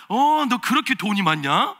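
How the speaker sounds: slow, mocking jab at the daughter-in-law; sarcastic jab. Mother-in-law mocking her sick daughter-in-law's spending, slow sarcastic jab. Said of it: "비아냥대고".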